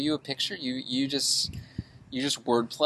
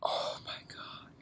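whispered disbelief; 12 dB quieter, slower than usual. Real, not acted. Reacting to donut fries claim.

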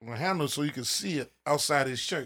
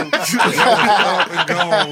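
laughing; 4 semitones higher, 12 dB louder, much slower than usual. Real, not acted. Laughing recalling quick wins.

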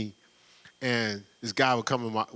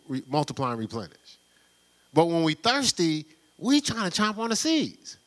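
rising preaching; 6 semitones higher than usual. Acted. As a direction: preach it up the scale to the congregation. Preacher builds to full force.

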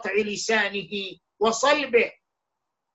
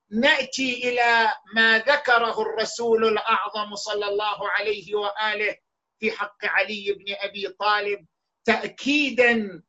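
normal talking voice

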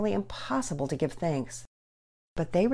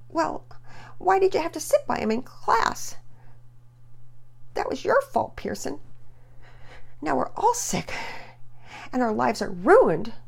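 accusing; 10 semitones higher than usual. Acted. Sister blaming her brother for the sickness, high accusing. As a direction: point the finger at her brother. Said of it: "Penelope said accusingly".

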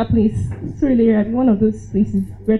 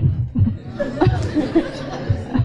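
short airy giggle; soft laugh; 5 semitones higher, 5 dB quieter than usual. Real, not acted. Recalling her naive thought, soft giggle. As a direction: let a quiet laugh slip out.

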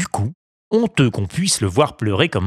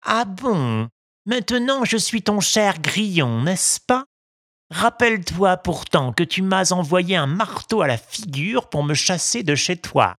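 reproachful cricket; 9 semitones higher than usual. A narrator's reading.

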